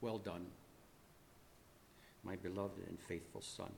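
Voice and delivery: hushed, solemn approval; father's voice. A narrator's reading. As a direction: speak as a father quietly commending a son. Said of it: "I heard the voice of my father".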